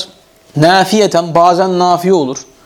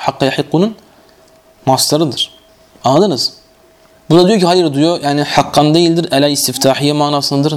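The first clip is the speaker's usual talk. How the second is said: ordinary delivery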